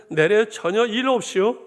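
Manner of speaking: 내래 전혀 일 없이요 in Hamgyeong dialect, breaking into a laugh. Retold or imitated. Pastor amuses the church in Hamgyeong dialect, laughing. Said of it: "함경도 버전 하나 더 할까요".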